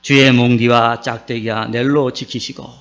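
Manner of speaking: Psalm 23 recited in Gyeongsang dialect, slowed a little; Gyeongsang dialect recital. Pretend. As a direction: recite it like a Gyeongsang grandpa, lightly. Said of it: "경상도 사람들이 이 시를 이렇게 읊습니다". (가볍게 흉내 낸 경상도 사투리 낭송)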